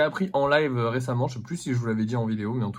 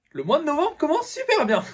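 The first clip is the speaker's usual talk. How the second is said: much higher, laughing irony; sarcastic laugh; 14 semitones higher, 3 dB louder than usual. Real, not acted. Ironic laughing complaint. (ironie en riant)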